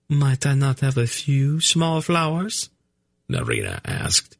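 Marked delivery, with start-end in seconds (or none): 0.1-2.7 high, timid noblewoman; high, courtly plea; high lady voice. Acted. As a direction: ask meekly for one more thing. Narina asking timidly.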